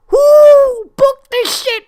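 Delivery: high, loud, slow wail; outraged shout. Real, not acted. Fan fuming at the booking, loud slow anguished shout. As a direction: howl it in disbelief.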